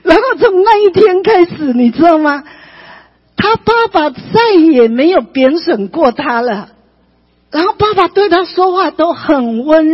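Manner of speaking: high, loud testimony delivery; impassioned preaching. Real, not acted. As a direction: preach the turning point with rising fervour. (高亢激昂地讲道)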